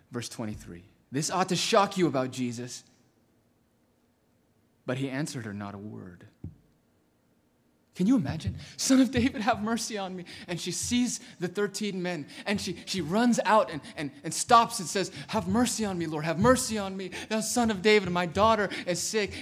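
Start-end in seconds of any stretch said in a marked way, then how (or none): 1.1-15.1 loud, high, fervent preaching; preaching at full force. Acted. 15.3-19.3 loud, high pleading cry for mercy; desperate cry. Acted. Woman crying out to Jesus.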